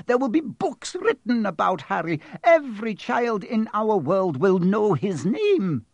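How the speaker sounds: high, emphatic objection; incredulous protest. A narrator's reading. McGonagall objecting to explaining it in a letter, incredulous. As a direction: argue with rising agitation.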